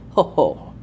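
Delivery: low mock ho-ho of satisfaction; old professor's lightly voiced low chuckle; satisfied chuckle. Van Helsing pleased at spell.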